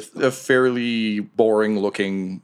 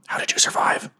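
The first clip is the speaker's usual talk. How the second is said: whispered question to a crewmate; hushed, covert whisper; secretive whisper. Acted. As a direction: ask it under your breath, all air. Secret reunion with hiding crewmate.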